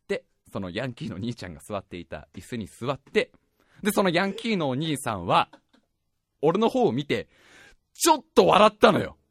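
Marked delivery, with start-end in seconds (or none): none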